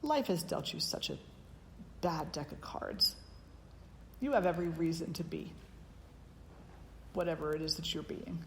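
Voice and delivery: softly imitating a pitying, syrupy tone; mock the pity, soft and condescending; a hint of patronizing sympathy. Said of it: "I won't say".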